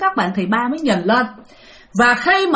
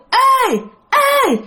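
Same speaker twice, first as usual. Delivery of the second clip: very high, ringing cry; pig with girl's voice; 11 semitones higher than usual. Retold or imitated. Giant pig in the tree calls down, high girlish cry. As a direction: call out high as a demon pig. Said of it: "Mà giọng con gái nha".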